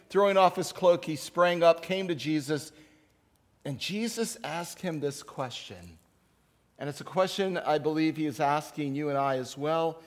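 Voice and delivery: ordinary talk, nothing marked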